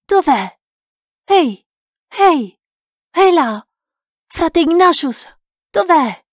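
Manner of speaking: loud, high calls, each falling away; repeated shouting. A narrator's reading. Lost man calling Frate Ignatius in the wood, shouting. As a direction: holler for someone out of sight. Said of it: "Continuai a gridare".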